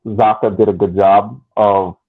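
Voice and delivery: ordinary talk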